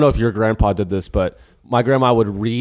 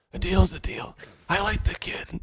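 Goodfellas mobster quote; 6 semitones higher, 10 dB quieter than usual. Retold or imitated. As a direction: quote the mob boss quietly, a light impression. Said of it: "like at the end of, like, Goodfellas".

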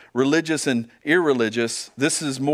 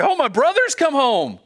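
much higher, bright excited praise; joyful rejoicing; 10 semitones higher, 3 dB louder than usual. Retold or imitated. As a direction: gush with joy at the brother's return. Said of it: "Does he rejoice and say".

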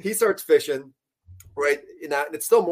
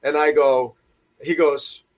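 ordinary talk, nothing marked

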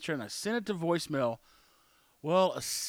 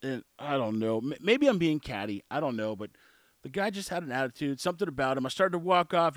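ordinary delivery

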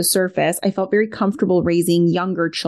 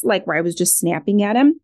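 usual speaking voice

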